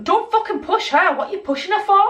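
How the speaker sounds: high-pitched retold telling-off; indignant confrontation. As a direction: challenge him, pitched well up. Retold confrontation over a push.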